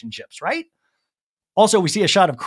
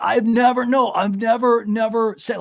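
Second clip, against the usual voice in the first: flustered defensive; 6 semitones higher than usual. Retold or imitated. Shep on the defensive.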